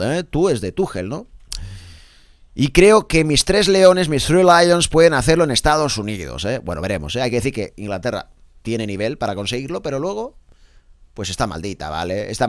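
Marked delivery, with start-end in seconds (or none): 2.5-6.4 slow, loud announcer emphasis; high, projected and drawn-out press-review read; headline quote read. Acted. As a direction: proclaim the coach's quote to the audience.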